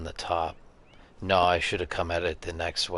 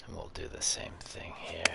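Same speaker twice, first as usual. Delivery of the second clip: whispering; 8 dB quieter than usual. Real, not acted. Player muttering to self.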